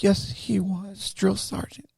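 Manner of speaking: high, soft, very slow reply; intimidated recruit. Retold or imitated. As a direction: answer carefully in a small mock-scared voice. Scared recruit answers sergeant.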